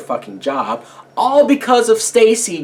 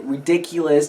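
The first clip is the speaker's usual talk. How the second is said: ordinary talk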